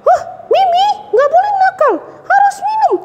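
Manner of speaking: puppet girl mimicking mom's scolding, still high; a light hint of a stern mother; mock scolding mom. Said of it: "Mama bilang".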